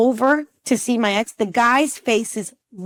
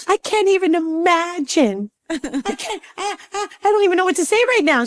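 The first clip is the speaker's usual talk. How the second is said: overwhelmed stammer; 9 semitones higher than usual. Retold or imitated. Acting out overwhelmed reaction to twins.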